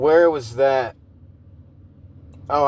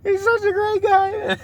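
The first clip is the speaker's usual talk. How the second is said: mock crying. Mocking Bill's emotional speech about another man, teary high sobbing. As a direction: ham up a weepy, sobbing confession. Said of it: "He's like".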